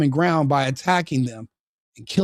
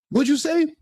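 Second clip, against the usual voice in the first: much higher, hammed-up indignant question; mock-indignant demand, pitched way up; mock outrage; 12 semitones higher than usual. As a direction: play the offended tough guy, over the top. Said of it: "I'm like".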